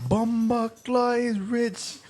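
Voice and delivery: high, slow Jamaican amazement; Jamaican accent. Retold or imitated. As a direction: marvel in a mock Jamaican accent. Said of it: "And he's like".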